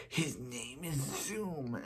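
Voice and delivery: hushed, slow villain voice; very soft, slow menacing figure; Zoom voice. Acted. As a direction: draw it out softly like a sinister villain. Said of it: "I do a very good Zoom voice".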